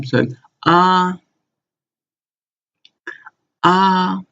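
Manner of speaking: ah high and level, said twice; first tone ah. Shown as a demonstration. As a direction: hold ah high and flat. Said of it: "I will say them twice".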